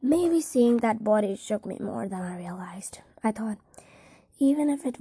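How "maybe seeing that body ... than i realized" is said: ordinary delivery, nothing marked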